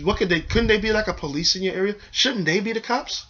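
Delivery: ordinary talk, nothing marked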